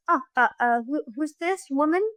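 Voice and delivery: lightly voiced puzzled mother, halting; confused mother. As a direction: stammer into a rising, uncertain question. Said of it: "my mother forgets who my daughter is".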